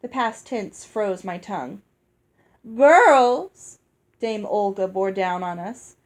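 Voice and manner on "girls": put-on loud call to the girls; loud, bossy summons. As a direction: summon them sharply across the room. Dame Olga calls the girls.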